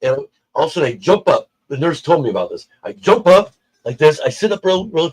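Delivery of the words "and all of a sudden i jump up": ordinary delivery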